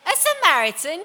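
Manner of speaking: loud, high, incredulous query; shouted question. A narrator's reading. Someone in the crowd hears of a Samaritan, shouted. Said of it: "shouted someone".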